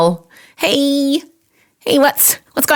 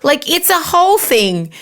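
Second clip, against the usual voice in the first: ordinary delivery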